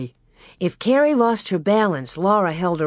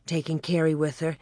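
ordinary delivery, nothing marked

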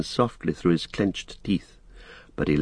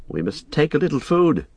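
lightly voiced hobbit, higher than the narration; Frodo, kindly; 7 semitones higher than usual. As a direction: offer food in a higher hobbit voice.

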